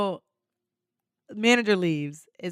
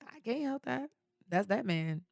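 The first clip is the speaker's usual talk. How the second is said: very soft, blank-faced reply; hushed deadpan denial; 4 semitones higher, 12 dB quieter than usual. Retold or imitated. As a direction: shrug it off quietly, without feeling. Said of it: "with a dead blank face".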